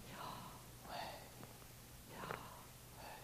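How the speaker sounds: Yahweh whispered as pure breath. Shown as a demonstration. Preacher leading quiet prayer with a breathed Yahweh. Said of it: "Just breathe".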